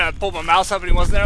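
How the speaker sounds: ordinary delivery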